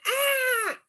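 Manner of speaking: mock scream of a startled mom; high imitation of her own scream; retold scream. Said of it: "And then I scream".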